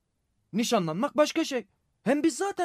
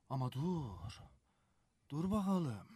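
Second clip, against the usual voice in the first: very soft, low, to himself; hushed, low pondering; musing suspicion; 8 semitones lower, 13 dB quieter, slower than usual. Acted. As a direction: think aloud as a doubt dawns. Thinking to himself, suspicion dawning.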